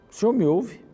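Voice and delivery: high, loud rejoicing; joyful certainty. Pretend. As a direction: proclaim it, overjoyed. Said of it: "Mas no verso três ele diz assim".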